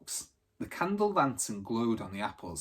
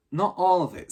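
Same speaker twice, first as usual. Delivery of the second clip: Thunderbolt's voice; 7 semitones higher, 8 dB louder than usual. Acted. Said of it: "said Thunderbolt".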